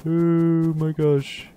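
ordinary delivery

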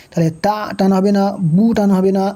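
ordinary talk, nothing marked

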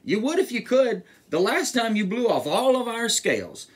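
high storybook fish plea; fish character. A narrator's reading. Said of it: "said the fish".

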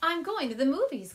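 called out to family in another room; calling out. Acted. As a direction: Claire calling to her family about the movies. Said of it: "Claire called to her family".

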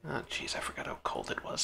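whisper. Acted. Character shivering in cold, whispered.

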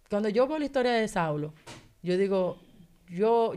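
ordinary talk

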